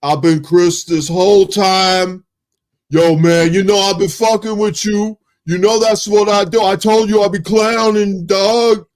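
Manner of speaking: playful Chris impression, loud and cocky. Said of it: "it started off at Chris".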